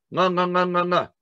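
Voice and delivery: mock gasps of a drowning man; gasping for breath. Shown as a demonstration. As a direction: gasp like someone drowning. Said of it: "what do you say".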